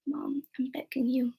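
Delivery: whispered plea to mom; pleading whisper. Retold or imitated. Begging her mother not to invite her birth mom, whispered plea. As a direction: beg her in a hushed whisper.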